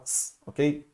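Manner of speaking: ordinary talk, nothing marked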